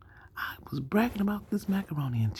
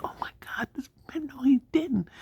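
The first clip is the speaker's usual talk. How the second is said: mock shocked lady; 10 semitones higher than usual. Counter lady voiced in story.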